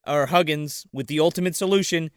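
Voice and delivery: ordinary talk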